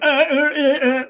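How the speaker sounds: high-pitched stuck "uh" noises; mock blanking stammer. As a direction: stammer as if your mind has locked up. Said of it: "it's a mental block".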